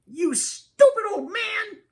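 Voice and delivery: very high, slow, furious screech; high-pitched scolding shriek; Lydia screeching. A narrator's reading. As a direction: screech the insult in a piercing voice. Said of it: "Lydia screeches".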